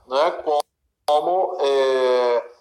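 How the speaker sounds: ordinary talk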